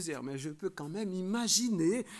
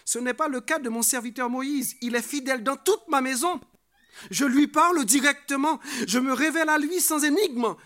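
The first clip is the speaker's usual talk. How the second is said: loud, raised scripture proclamation; emphatic preaching; 7 semitones higher, 10 dB louder than usual. Acted. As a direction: declaim the verse, building higher and louder. Reads God's words forcefully to congregation. (prédication proclamée avec force)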